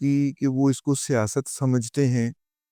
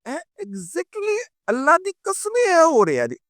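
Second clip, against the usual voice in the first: exasperated exclaim; 12 semitones higher than usual. Real, not acted. (اونچی، کھنچی ہوئی جھنجھلاہٹ)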